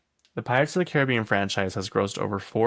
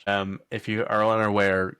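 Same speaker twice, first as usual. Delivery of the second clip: ordinary talk, nothing marked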